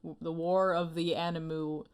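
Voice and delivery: ordinary talk, nothing marked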